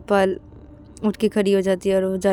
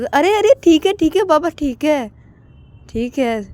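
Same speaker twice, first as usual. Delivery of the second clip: high loud then fading okay okay; conceding; 8 semitones higher, 4 dB louder than usual. Acted. Aakash conceding the argument, high then fading. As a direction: give in, trailing off.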